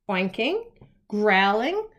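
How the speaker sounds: plain reading voice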